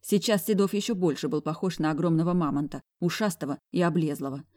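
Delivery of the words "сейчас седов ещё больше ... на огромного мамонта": ordinary delivery, nothing marked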